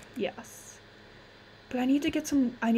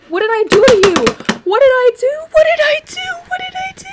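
screamed plea climbing to a squeal; very high, wailing panic; overdramatic freak-out; 11 dB louder, faster than usual. Real, not acted. Gamer asking the computer what they did, overdramatic screaming.

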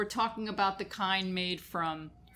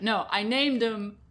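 normal talking voice

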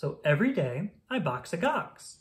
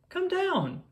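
calling out. A narrator's reading. Children calling someone down to play.

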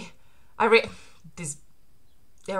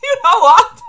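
excited; 11 semitones higher, 13 dB louder than usual. Real, not acted. Excited reaction reading manga.

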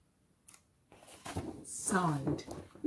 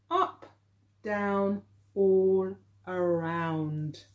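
slow title reading; 2 semitones lower, 3 dB quieter, much slower than usual. Shown as a demonstration. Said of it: "this title's written in capital letters".

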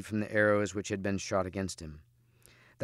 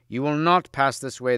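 raised, louder challenge; higher, forceful warning cry; challenging cry; 4 semitones higher, 6 dB louder than usual. A narrator's reading. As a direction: call out a halt to a rider. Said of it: "they cried".

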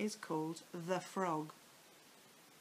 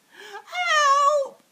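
high, loud panicked scream; screaming for help; 17 dB louder than usual. A narrator's reading. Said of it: "screamed Missus Twit".

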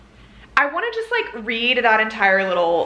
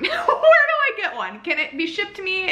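squealing where do I get one; laughing swoon; 8 semitones higher than usual. Real, not acted. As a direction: gush through a laugh. Swooning over fan cast photo.

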